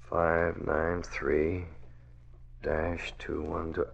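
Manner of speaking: soft muttering while searching; muttering to himself. Acted. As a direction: think aloud quietly so no one hears. Searching a dark office alone.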